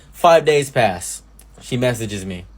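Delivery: ordinary talk, nothing marked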